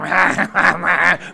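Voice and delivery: goofy put-on cackling gabble; manic imitated noise. Mimicking camper hooked on Mountain Dew, manic gabble. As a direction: mimic an annoying kid's crazed noise. Mimics the annoying kid guzzling soda.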